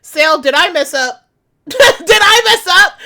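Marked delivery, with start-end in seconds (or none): 1.7-2.9 laughing disbelief. Real, not acted.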